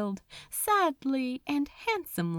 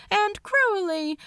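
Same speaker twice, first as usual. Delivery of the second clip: plain reading voice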